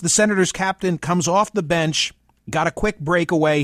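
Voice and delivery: ordinary talk, nothing marked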